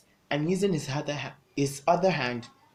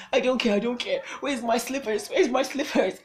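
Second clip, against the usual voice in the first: high, hurried panic while searching for slippers; frantic, panicked; 8 semitones higher than usual. Retold or imitated. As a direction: scramble in a panic to get out.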